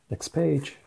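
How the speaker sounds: usual speaking voice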